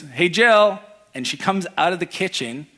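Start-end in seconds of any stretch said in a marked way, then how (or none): 0.0-0.8 louder mock call across the house. New hire calling his wife from the hallway, a mild mock yell. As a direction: call out to the kitchen. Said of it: "And he screams".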